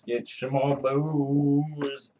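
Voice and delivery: slow, slurred mock-drunk begging; drawn-out booze plea. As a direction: stretch out the last word like a drunk.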